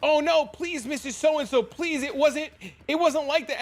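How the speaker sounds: high, pleading mock whine; pleading principal. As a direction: beg the furious mom to calm down. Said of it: "the principal was like".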